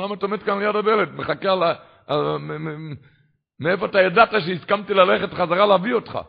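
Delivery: loud, fast, high amazed asking; astonished question. Retold or imitated. Father finds his son dressed at the door, loud amazement. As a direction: burst out in wonder at the child.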